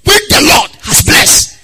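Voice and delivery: preacher crying out the blessing, slow and high; rising preaching climax. Acted. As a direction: build it up to a peak.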